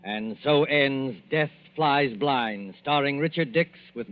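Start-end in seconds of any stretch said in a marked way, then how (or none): none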